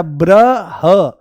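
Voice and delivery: ब्रह stretched very long; drawn-out syllable. Shown as a demonstration. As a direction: drag out ब्रह slowly for the class. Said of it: "यहां पर क्या बचा?". (ब्रह को बहुत खींचकर बोलना)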